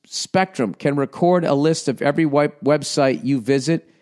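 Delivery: normal talking voice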